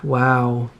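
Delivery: normal talking voice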